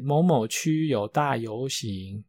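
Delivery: slow, formal PA announcer voice; theme park announcement. Retold or imitated. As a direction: read it like a park loudspeaker notice. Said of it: "那我又不是播报员". (慢速的游乐园广播腔)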